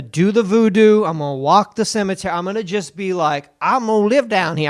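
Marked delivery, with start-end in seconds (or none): 3.6-4.7 lightly put-on New Orleans accent, a bit higher. Host previews his trip voice, a light New Orleans accent. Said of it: "I'm gonna talk like that".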